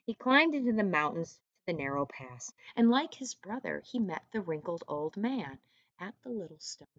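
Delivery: put-on reading aloud to children; storyteller voice for young kids; story narration. A narrator's reading. Teacher reads story to class.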